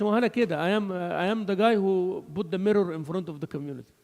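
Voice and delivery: ordinary delivery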